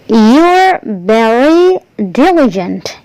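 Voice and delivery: slow, careful "you're very diligent" with the linking be; correct version, slow. Shown as a demonstration. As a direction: spell out "you're" clearly and slowly. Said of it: "Yang benar adalah".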